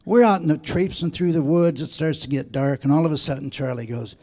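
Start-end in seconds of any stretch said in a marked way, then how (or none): none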